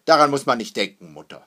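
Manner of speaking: loud, dismissive opening, dropping on the name. A narrator's reading. Father rebuffs his worried wife, loud and dismissive. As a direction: brush the worry aside firmly. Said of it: "sagte Hackendahl abweisend".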